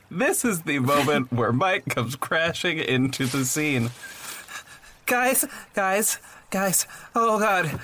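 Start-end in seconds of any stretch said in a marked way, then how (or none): 5.1-7.8 panicked. Acted. Mike bursts in panicked.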